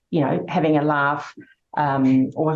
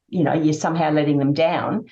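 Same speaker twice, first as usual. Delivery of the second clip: ordinary delivery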